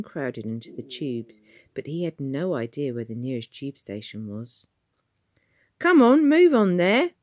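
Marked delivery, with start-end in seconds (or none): 5.8-7.1 high, loud brisk warden order. A narrator's reading. Warden ordering people to the shelter, loud and brisk. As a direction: herd the crowd to safety. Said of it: "a loud, brisk voice".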